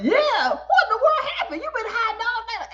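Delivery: high-pitched excited clamor; hammed-up, high astonished outburst; amazed crowd. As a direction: imitate a crowd crying out in amazement.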